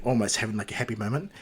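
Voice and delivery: usual speaking voice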